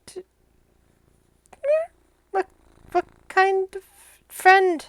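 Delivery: very high, hesitant little creature; timid flying monkey. Acted. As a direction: ask warily as a little monkey. Monkey voiced, asking timidly.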